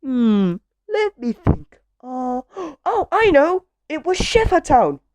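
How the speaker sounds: high, loud scatty character; animated high-pitched storybook voice; Miss Scatterbrain. A narrator's reading. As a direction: think aloud, then gasp with sudden realisation. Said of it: "said Miss Scatterbrain".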